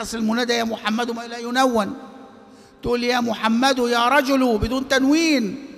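ordinary talk

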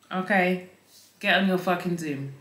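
usual speaking voice

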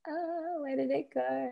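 soft, high-pitched searching to oneself; absent-minded self-talk. Real, not acted. Searching for a lost question.

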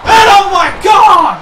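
loud, high, panicked commentary shouts; frantic disbelief. Real, not acted. Commentator begging the action to wait, shouting frantically. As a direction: yell as the match turns suddenly.

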